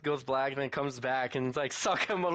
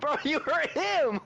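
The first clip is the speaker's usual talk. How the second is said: slow, high-pitched laughing exclamation; laughing protest; 10 semitones higher, slower than usual. Real, not acted. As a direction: call it out through laughter. Laughing at the retold scene.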